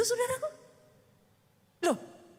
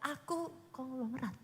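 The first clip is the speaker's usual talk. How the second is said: much softer, slow and timid; meek mumble; 12 dB quieter than usual. Shown as a demonstration. Preacher mocks a timid believer, meek mumble. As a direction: say it without belief. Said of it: "Jangan mulutnya ngomong".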